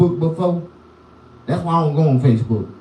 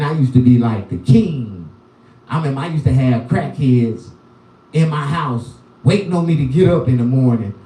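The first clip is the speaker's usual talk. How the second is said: ordinary talk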